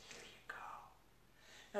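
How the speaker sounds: very soft whispered hand-over; hushed whisper. Retold or imitated. Handing her CDs out to people, whispering. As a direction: drop right down into a slow whisper.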